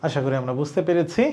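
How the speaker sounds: normal talking voice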